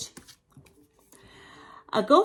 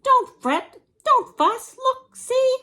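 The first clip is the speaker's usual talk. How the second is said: very high, loud little mouse voice; squeaky mouse; 7 dB louder than usual. Acted. Mouse reassuring the crying bear, high squeaky voice. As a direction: squeak it out in short bursts. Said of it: "Mouse squeaks".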